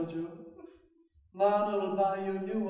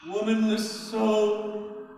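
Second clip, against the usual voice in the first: ordinary talk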